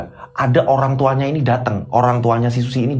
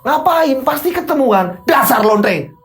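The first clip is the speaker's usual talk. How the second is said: high, heated, abusive outburst; loud insult; 10 semitones higher, slower than usual. Acted. Husband accusing wife of secret meetings, loud angry insult. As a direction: fling the accusation out at full heat. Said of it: "tadi saya sampai kenceng ngomongnya gitu".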